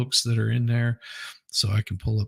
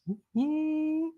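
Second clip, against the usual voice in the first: high squealed cheer, much higher; excited woo-hoo; 6 dB quieter than usual. Real, not acted. Host cheering a viewer joining the stream, high excited squeal.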